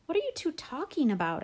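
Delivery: high-pitched demand, no real volume; mild narrated shout; shouted question. A narrator's reading. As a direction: pitch it way up, bewildered and cross. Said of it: "I shouted".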